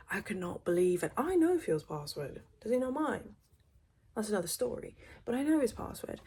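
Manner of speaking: loud exasperated outrage; indignant rant. Real, not acted. Telling viewers she knows her husband's password, loudly indignant. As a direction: vent it at full volume.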